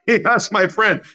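ordinary delivery

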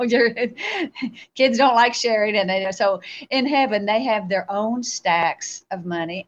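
ordinary talk

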